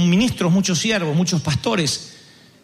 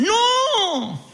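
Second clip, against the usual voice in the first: higher, alarmed denial; alarmed protest; 10 semitones higher, slower than usual. Retold or imitated. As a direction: cry out that it must be a mistake. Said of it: "Todos dicen". (protesta alarmada, aguda)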